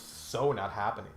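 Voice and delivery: ordinary delivery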